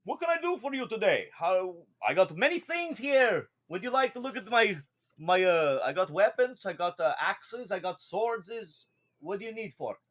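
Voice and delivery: high, wobbly merchant voice; eager fish shopkeeper with a wobble; wobbly fish man. Acted. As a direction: hawk your wares as a fish man. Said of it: "a wobble in his voice 'cause he's a fish man".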